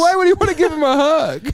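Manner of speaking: high-pitched comic disbelief; mock incredulity. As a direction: squeal the question in mock outrage. Riffing on the retold incredulous customer.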